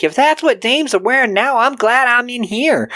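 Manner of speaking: high-pitched, swooping character voice; exaggerated high cartoonish quip; Smoochie wisecrack. Mocking character quote in plot retelling.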